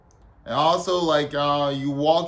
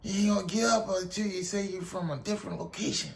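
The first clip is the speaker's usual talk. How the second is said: higher mock sergeant threat; Drill Sergeant Kickass; 3 semitones higher, faster than usual. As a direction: lay down the law as a put-on drill instructor, without shouting. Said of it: "He was like".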